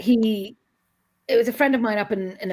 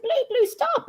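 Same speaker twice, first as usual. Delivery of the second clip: high, urgent call to stop; yelling at the dog. Retold or imitated. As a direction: call the dog off the boy in alarm. Yelling at dog attacking boy.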